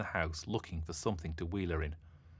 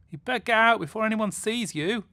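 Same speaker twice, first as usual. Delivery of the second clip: high, loud shouted warning across the pond; yelling; 10 dB louder than usual. Acted. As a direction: call out to the swimmer at full voice. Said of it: "yelled Jay".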